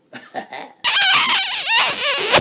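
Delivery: high loud shriek of laughter. Real, not acted. Loud shrieking laugh at the story. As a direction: cackle at the absurdity.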